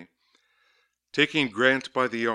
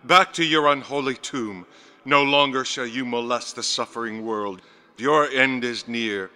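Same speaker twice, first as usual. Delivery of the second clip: louder, forceful exorcist's command; loud banishing cry; 3 semitones higher, 7 dB louder than usual. A narrator's reading. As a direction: drive her back to the tomb. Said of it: "I cried".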